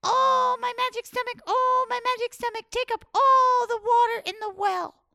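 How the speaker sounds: high, loud rooster summons; calling out. Acted. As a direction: call to the magic stomach. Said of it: "he called out".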